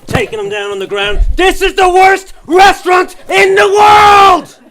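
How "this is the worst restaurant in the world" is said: screamed at the top of the voice, much higher, slow; mock outraged shout. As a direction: bellow it like a meltdown in a restaurant. Adult tantrum in McDonald's.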